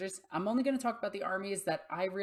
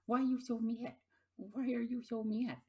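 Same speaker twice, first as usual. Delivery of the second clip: higher teasing pet voice; 6 semitones higher than usual. Real, not acted. Teasing her cat about looking mad, higher baby talk. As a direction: coo at the grumpy cat.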